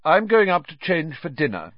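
lofty. A narrator's reading. Percy leaving to change for dinner, lofty. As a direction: excuse yourself grandly. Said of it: "said Percy loftily".

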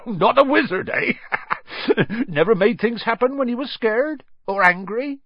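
amused. Acted. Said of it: "Hagrid chuckled".

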